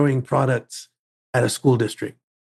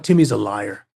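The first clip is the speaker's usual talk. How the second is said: dismissive principal; 5 semitones lower than usual. Retold or imitated. Principal dismissing boy on phone.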